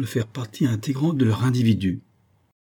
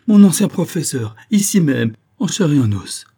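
high, loud amazed recognition; astonished exclamation; 7 semitones higher, 7 dB louder than usual. A narrator's reading. Captain finds his old professor alive, astonished cry. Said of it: "s'écria-t-il".

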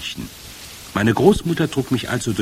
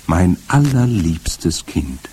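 slow, lower, wondering; slowly; 6 semitones lower, slower than usual. A narrator's reading. Grandmother slowly marvels at her clever grandson. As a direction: let each word land slowly. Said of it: "sagte sie langsam".

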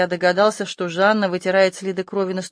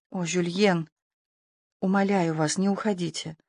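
hushed, understated begging; soft pleading; 6 dB quieter than usual. A narrator's reading. Frightened woman begs him to stay. (тихая, сдержанная мольба)

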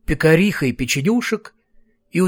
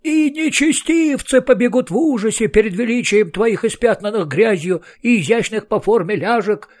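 much higher, fervent other voice; unflagging enthusiasm; 7 semitones higher than usual. A narrator's reading. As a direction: gush it with relentless zeal. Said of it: "с несгибаемым энтузиазмом".

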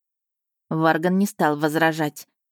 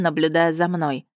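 ordinary delivery

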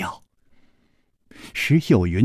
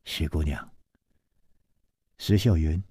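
low, hushed calling of her name; soft call; 7 semitones lower, 5 dB quieter than usual. A narrator's reading. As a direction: call out gently under your breath, pitched low. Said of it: "才轻轻地唤道". (低声轻唤)

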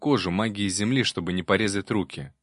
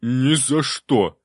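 refusing demon voice; 3 semitones higher, 5 dB louder, much slower than usual. A narrator's reading.

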